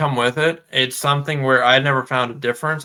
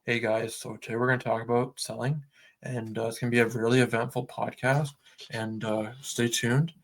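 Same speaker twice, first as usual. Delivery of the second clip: softer, little movement in the pitch; low-key monotone; 3 semitones lower, 5 dB quieter than usual. Shown as a demonstration. Host demonstrates an unengaging podcast intro, low-key monotone. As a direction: drone through the intro with low energy. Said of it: "So if I'm like".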